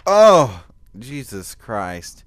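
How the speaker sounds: real pained outburst; sharp pained cry. Real, not acted. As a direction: yelp as the hiccup stabs. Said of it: "it hurts".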